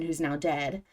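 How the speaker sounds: usual speaking voice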